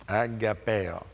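"Agapeo" with a playful Italian accent. Shown as a demonstration. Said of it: "Italian accent".

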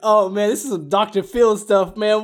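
high, loud incredulous; excited disbelief. Real, not acted. A friend reacting to a wild confession, loud disbelief. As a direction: hype up the drama.

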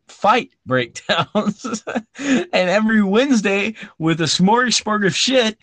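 high, giggly laughing sign-off. Real, not acted. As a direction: giggle through the outro.

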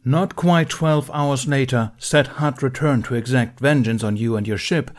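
loud, firm dressing-down; stern Jedi master. Acted. Mace Windu scolding Ben before the council. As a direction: read the charges like a cold judge.

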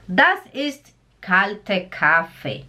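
slow, measured German phrase; slow model. Shown as a demonstration. Teacher recapping the expression for learners, slow and clear. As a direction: recap it slowly, word by word.

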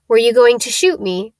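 ordinary delivery, nothing marked

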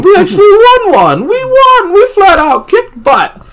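very high, loud whooping; giddy, shrieked cheer; ecstatic celebration. A narrator's reading. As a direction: squeal the victory with a laugh. Characters celebrate victory.

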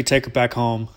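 usual speaking voice

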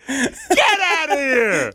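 high, slow laughing cry of disbelief. Real, not acted. Host still laughing in disbelief at his cohost's story. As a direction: stretch it out while cracking up.

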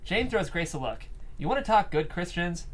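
normal talking voice

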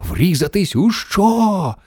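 high, drawn-out horrified question; yelled in alarm. A narrator's reading. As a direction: squeal it slowly in disbelief. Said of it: "зарепетували всі".

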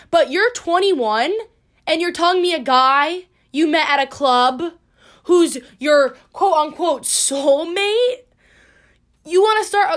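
can't believe what she's hearing; incredulous rant. Retold or imitated. Incredulous rant at friend's choice.

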